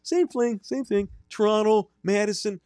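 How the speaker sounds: put-on high-pitched Wisconsin hockey accent; high, sing-song Midwestern creep. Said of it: "I got locked into characters".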